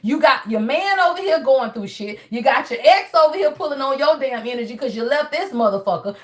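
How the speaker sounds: usual speaking voice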